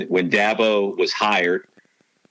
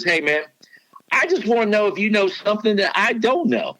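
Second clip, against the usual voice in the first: imitating the coach's Southern twang, much higher; 12 semitones higher than usual. Coach quizzing a reporter in his Southern twang. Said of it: "and he's got the twang going".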